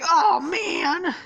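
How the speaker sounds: loud, very high cartoon wail; hammed-up high-pitched anguish; wailing Shaggy. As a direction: cry out like a wounded cartoon slacker. Shaggy shot in the leg.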